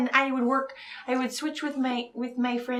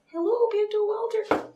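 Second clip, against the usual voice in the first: cutesy pet greeting; 9 semitones higher than usual. Pretend. Greeting her cats in books.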